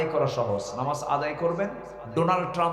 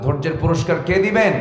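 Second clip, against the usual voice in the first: louder, higher demand for an answer; insistent repeat; 5 semitones higher, 7 dB louder than usual. Real, not acted. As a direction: push the question out to the crowd. আসতে বলুন. (জোর দিয়ে আবার প্রশ্ন)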